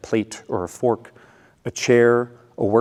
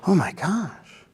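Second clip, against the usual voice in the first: slow, higher-pitched dismay; acted sympathetic concern; 8 semitones higher, slower than usual. Boyfriend hears girlfriend's frustration, acted sympathetic concern. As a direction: take it in slowly with concern.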